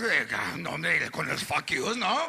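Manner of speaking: grandmother's voice. Retold or imitated. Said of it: "my grandmother would say".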